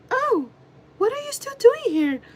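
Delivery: put-on high-pitched coworker; mock her in a very high, fake voice; fake sweet coworker voice. Coworker greeting the one who is leaving, fake high voice. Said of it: "make a voice like that".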